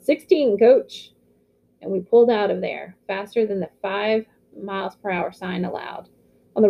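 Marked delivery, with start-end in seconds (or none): 0.0-1.1 higher yelled reply to the coach; yelled answer. Acted. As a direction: shout the headcount back.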